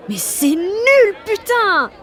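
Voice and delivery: high, loud frustrated yell; frustrated outburst. Acted. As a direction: explode at your own shyness.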